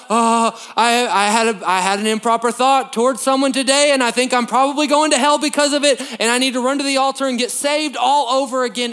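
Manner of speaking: mock anxious believer. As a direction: play a panicky churchgoer confessing. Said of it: "it's just like".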